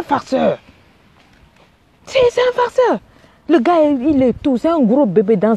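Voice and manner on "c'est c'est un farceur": high, indignant declaration; outraged verdict. Real, not acted. Coach warning a woman about her boyfriend, indignant. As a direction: call him out, much higher.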